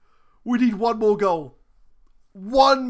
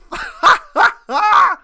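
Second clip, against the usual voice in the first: elated high laugh; 10 semitones higher, 7 dB louder than usual. Real, not acted. Elated laugh after the goal.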